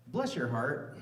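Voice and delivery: put-on Southern accent; Asheville Southern accent. Shown as a demonstration. Comic translating the curse for locals, Asheville Southern accent. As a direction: sweetly dismiss them in an Asheville drawl. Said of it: "my best attempt at an Asheville accent".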